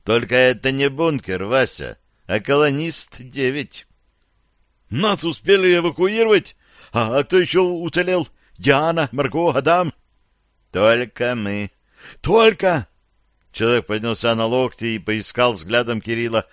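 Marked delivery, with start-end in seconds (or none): 4.9-12.9 dazed survivor. Acted. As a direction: tell it like a man waking from a nightmare. Survivor asks who survived.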